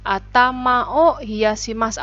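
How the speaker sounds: "Atama o hiyashimasu" said slowly, every syllable clear; model pronunciation. Shown as a demonstration. Teacher slowly models "Atama o hiyashimasu" for students. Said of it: "Hati-hati dihafalkan ya".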